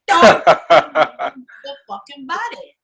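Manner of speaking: a hint of an angry dad; imitating father.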